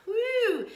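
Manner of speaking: high exhaled "woo"; effortful whoop. Real, not acted. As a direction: let out the strain in a high whoop. Exhausting push-up during live workout.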